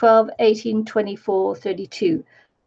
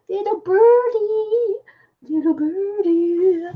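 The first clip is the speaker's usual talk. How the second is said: high, slow cooing little birdie; sing-song high baby talk, slow; 9 semitones higher, slower than usual. Pretend. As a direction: coo it like to a pet bird. Cooing over a bird sticker.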